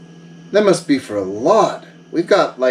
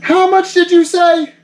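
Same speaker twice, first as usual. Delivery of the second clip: jumps way up in pitch, stunned; can't believe the price he hears; shocked question; 11 semitones higher than usual. Acted. Buyer hears the gear price from Yoshi, shocked high question.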